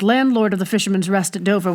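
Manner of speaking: usual speaking voice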